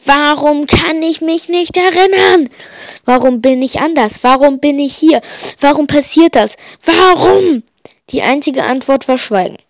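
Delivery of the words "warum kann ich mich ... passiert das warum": building desperate shrieks, higher and louder; verzweifeltes Kreischen. Acted. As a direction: scream each why louder at the sky. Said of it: "kreischte ich". (immer lauter kreischend)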